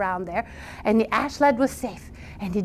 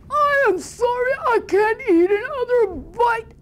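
high, slow, groaning troll; stuffed troll; 13 semitones higher than usual. Acted. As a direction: whine that you can't eat more. Troll too full.

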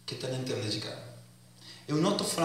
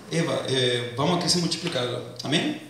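normal talking voice

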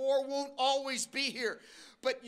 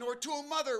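ordinary talk

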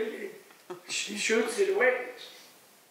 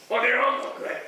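higher, louder imitation of a protester; mock ranting; 4 semitones higher, 5 dB louder than usual. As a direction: mimic a protester's indignant rant. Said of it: "it goes ranting and raving how horrible they are".

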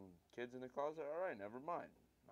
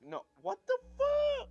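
slow, very high shriek of panic; real frightened squeal; panicked shriek; 17 dB louder, slower than usual. Real, not acted. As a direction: shriek like you can't believe what just jumped you. Jumpscare hits the player.

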